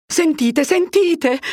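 urgent high, loud call; calling after someone. A narrator's reading. Curate calls after Fermo walking away, urgent shout. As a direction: call out to stop him leaving.

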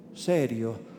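ordinary delivery, nothing marked

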